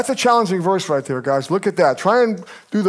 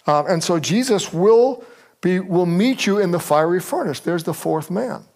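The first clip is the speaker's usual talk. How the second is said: ordinary talk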